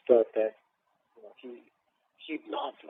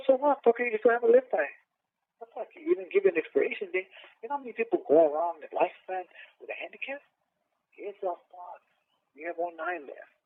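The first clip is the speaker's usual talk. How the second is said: high-pitched, insistent dismissal; exasperated reassurance in a raised pitch; retold tough pep talk; 8 semitones higher than usual. Acted pep talk to sick brother.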